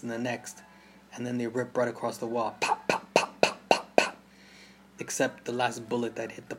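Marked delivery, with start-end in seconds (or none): 2.6-4.2 sharp rising pops of gunfire; gunshot pops. A narrator's reading. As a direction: spit out the pops like shots. Gunfire imitated in story.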